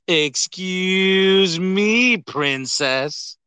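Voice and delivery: ordinary talk, nothing marked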